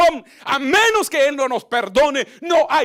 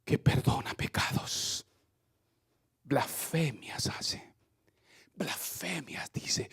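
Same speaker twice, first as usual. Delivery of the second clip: hissed whisper, slow and much softer; whispered accusation; 10 semitones lower, 12 dB quieter, slower than usual. Acted. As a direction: whisper it like scandalized gossip. Crowd murmuring accusation, whispered.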